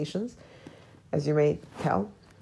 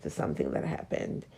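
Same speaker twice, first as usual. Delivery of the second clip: whispered inner thought; 8 dB quieter than usual. Retold or imitated. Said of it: "I was like".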